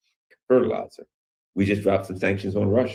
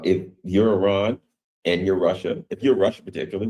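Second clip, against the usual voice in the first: usual speaking voice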